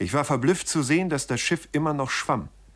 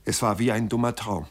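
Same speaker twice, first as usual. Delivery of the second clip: plain reading voice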